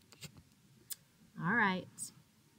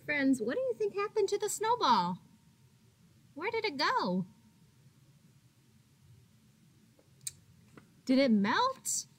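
higher, quick, bright questions; 7 semitones higher than usual. Real, not acted. Teacher asks children questions.

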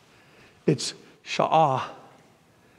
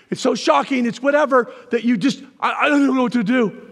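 higher, mock flustered helplessness; flustered panic; 6 semitones higher, 4 dB louder than usual. Preacher mimicking someone unsure how to react, flustered. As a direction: act out being too shocked to react. Acting out the overwhelmed person.

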